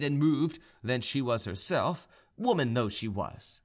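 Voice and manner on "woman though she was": usual speaking voice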